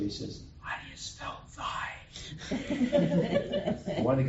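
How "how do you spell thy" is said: hushed, voiceless whisper; acted confidential whisper. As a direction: whisper it as a secret question. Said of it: "he whispers to her".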